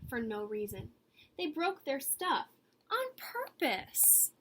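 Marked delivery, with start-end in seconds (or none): 2.9-4.3 high, slow, drawn-out emphasis; teasing emphasis for children; stress. A narrator's reading. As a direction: lean hard on the naughtiness. Naughty eggs list.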